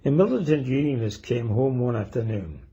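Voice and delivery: normal talking voice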